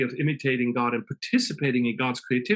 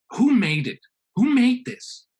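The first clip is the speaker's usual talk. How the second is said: rising in astonishment, louder; a louder, climbing who made this; amazed question; 9 semitones higher, 5 dB louder, slower than usual. Acted. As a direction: awestruck first human asking who made it all. First human amazed at garden.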